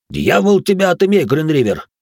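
loud, high furious curse; angry shout. A narrator's reading. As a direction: swear at him in anger. Salih swears at Richard. (злой выкрик)

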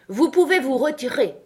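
high, loud royal permission to go; imperious dismissal. A narrator's reading. King dismissing the Hatter from court, imperious. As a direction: dismiss them as a ruling king.

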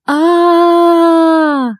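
high held scream; drawn-out panicked wail; long terrified scream. A narrator's reading. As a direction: scream while falling through the air. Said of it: "Мой собственный крик".

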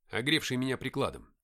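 ordinary delivery

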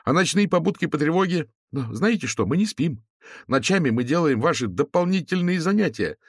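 high, strong ringing student voice. A narrator's reading. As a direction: let the voice grow firmer. Student continues his complaint.

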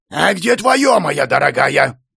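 exasperated husband's counterattack; angry retort. A narrator's reading. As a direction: throw the question back at your wife. Husband throws her question back.

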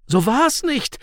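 high-pitched groan of protest; groaned denial. A narrator's reading. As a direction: moan it out as the accused, pitched right up. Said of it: "stöhnte Michael".

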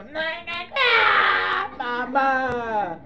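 long drawn-out mock wail to mum; imitated childish tantrum; whining child refusing. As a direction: whine like a toddler guarding a toy. Child refusing to lend a toy.

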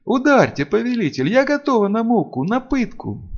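high, fervent pleading; ardent, high-pitched self-abasement; submissive vampire servant. A narrator's reading. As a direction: beg the master for punishment.

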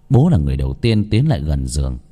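ordinary delivery

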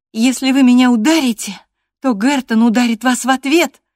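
high, very loud warning; defiant declaration. A narrator's reading. Girl warns the master off hitting her, defiantly. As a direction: declare it loudly, pitched well up. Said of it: "заявила она".

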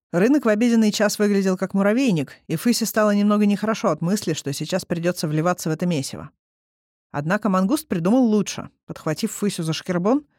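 plain reading voice

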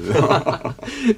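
high amused laugh rising. Acted. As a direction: friend laughing at an old shared memory. Old friends reminiscing.

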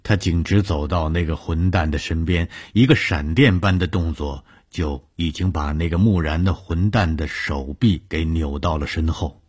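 ordinary delivery, nothing marked

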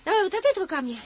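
ordinary talk, nothing marked

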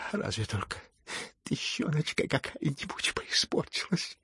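touched and tremulously grateful; tender emotion. A narrator's reading. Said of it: "с умилением выговорил Лебедев".

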